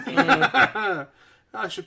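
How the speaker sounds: slow words through laughter; laughing. Real, not acted. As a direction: laugh while saying it. Laughing at own innuendo.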